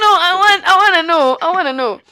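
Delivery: high, loud insistence over laughter; insistent protest. Real, not acted. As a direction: push back loudly, demanding to know. Protesting over co-host's objection.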